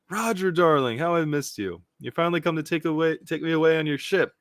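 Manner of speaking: lovestruck admirer. Acted. Gloriosa greeting her darling Roger, lovestruck gushing. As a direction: swoon over your long-missed darling.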